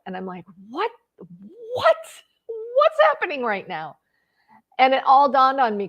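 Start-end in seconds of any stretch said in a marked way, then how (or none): 0.7-4.0 high-pitched, startled disbelief; freaking out. Retold or imitated. Woman reliving meeting her old bully, freaking out. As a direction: squeak it out in rising panic. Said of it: "started freezing and freaking out".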